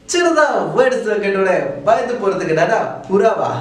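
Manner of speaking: usual speaking voice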